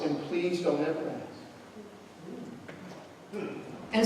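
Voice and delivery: soft, hushed story narration, drawing them in; quiet storytelling. Acted. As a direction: tell the tale gently, low and intimate. Preacher tells a quiet story.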